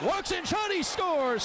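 yelled goal call, high and slow; excited goal call. Acted. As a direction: shout the goal like it's live.

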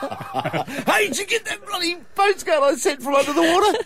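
high, giggly friend voice; squeaky mate asking about postcard; high laughing mock voice. Acted call to a friend.

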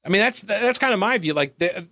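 usual speaking voice

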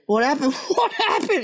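high-pitched mock alarm, laughing; retold startled question. Onlookers ask what happened, mimicked high through laughter.

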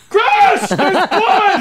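high shrieking cry of alarm; panicked scream. Pretend. Acted waking up to blood.

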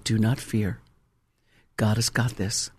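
low, soft and steady; quiet reassurance. Retold or imitated. Pastor steadies a frightened wife, quiet reassurance.

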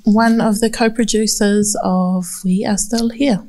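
ordinary talk, nothing marked

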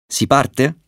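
high, rising query; eager question. A narrator's reading. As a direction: ask it pitched well up. (domanda acuta e impaziente)

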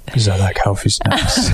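lightly put-on voice, amused; silly put-on voice. Defending the snack joke to partner, in a silly voice. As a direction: repeat it in a mildly silly voice. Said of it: "Why are you saying it in that voice?".

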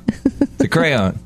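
crayon drawn out slowly in two syllables, cray-on. Shown as a demonstration.

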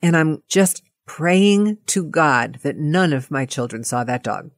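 ordinary delivery

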